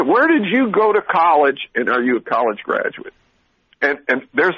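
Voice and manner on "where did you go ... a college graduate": mocking clerk's question. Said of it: "I was asking".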